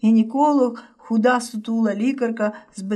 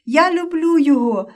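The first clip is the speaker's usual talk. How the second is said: high, louder cry that breaks off; desperate cry; 5 semitones higher, 3 dB louder than usual. A narrator's reading. Yulia crying out as the police take Roman, a high desperate cry. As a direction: cry out your love as it breaks. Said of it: "Голос зойком вирвався з уст і надломився".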